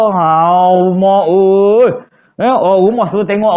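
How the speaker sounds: ordinary talk, nothing marked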